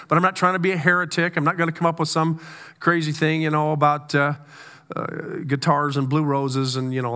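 ordinary delivery

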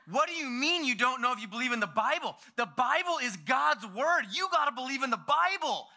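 put-on high, indignant eight-year-old; high-pitched, overwrought little believer; worked-up kid. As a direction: imitate a child in outraged disbelief. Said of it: "you're gonna have a heart attack".

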